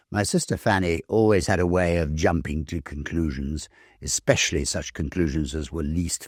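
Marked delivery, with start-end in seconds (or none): none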